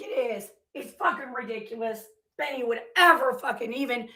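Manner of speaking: ordinary talk, nothing marked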